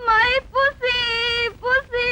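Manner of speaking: high, drawn-out theatrical wail; crying. Acted. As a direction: wail for a lost pet. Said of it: "திடீர்னு ஏன் அழுவுறீங்க".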